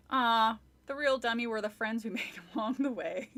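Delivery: plain reading voice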